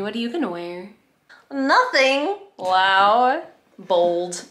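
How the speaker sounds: ordinary delivery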